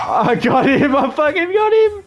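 rising to a thrilled high shout; excited yell. Real, not acted. Gamer to squad after a kill, thrilled high yell. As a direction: celebrate the kill, voice climbing.

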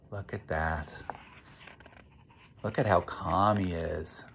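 ordinary talk

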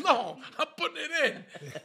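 high, loud laughing reply; laughing neighbour. Retold or imitated. Neighbour insists he is putting the rug in, laughing high.

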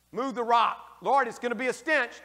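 Jesus orders, Martha protests, both loud; acted exchange. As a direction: call out the command and the objection. Preacher acts Jesus and Martha.

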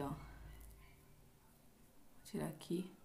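unvoiced, murmured under her breath; whisper. Real, not acted. Focused on peeling the mask, whispering to herself. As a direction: whisper it while concentrating.

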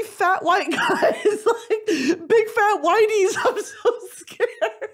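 high, slow, giggly telling; giggly mock fright; laughing. Real, not acted. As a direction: laugh out the fear. Laughing at mosh pit fear.

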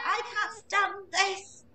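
high, slow, complaining; fed-up Mister Bear. A narrator's reading. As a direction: drag it out like a sleepless father. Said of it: "said Mister Bear".

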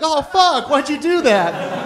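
high, drawn-out mock dismay; slow, high wail of complaint; exasperated groan. Table groans at his invitation.

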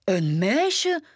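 exclaiming. A narrator's reading. Grumpy sees a girl on the bed, exclaiming. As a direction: cry out at the unexpected find. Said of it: "riep Grumpy uit".

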